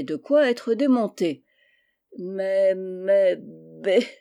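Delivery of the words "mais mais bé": stuck, bleating false starts on a monotone; drawn-out dumbfounded stutter; tongue-tied stammer. A narrator's reading. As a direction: stammer helplessly, unable to get words out. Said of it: "voilà tout ce qu'il put articuler".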